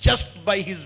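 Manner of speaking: high, slow proclamation; drawn-out, elevated sermon build; emphatic preaching. Real, not acted. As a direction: stretch each phrase out for the congregation.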